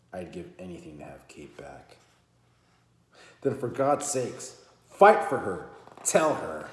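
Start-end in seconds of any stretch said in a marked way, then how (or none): 3.4-6.7 urgent plea. Acted. Alexandra urging Drew to fight.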